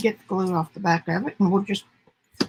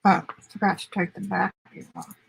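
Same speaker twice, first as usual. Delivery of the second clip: ordinary talk, nothing marked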